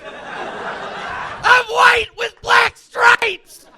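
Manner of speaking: high, very slow shrieked cartoon voice; cartoon zebra shriek. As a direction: drag it out high and squealing. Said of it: "That's the racist zebra".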